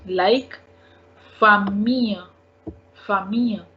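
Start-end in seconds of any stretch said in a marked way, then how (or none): 1.4-3.7 "famille" said fa-mee-yuh; silent double L. Shown as a demonstration. Teacher showing French double L, "famille" as fa-mee-yuh. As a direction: glide through "famille" with no L sound. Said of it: "We do not pronounce double L".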